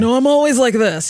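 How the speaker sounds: a playful fake insistence; mock insistent protest. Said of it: "I'd be like".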